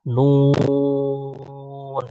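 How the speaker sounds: নুন stretched very slow, long held vowel; drawn-out nun. Shown as a demonstration. Teacher showing kids the letter, drawn-out slow নুন. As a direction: hold নুন out slowly like a chant. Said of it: "তাহলে এটা হলো".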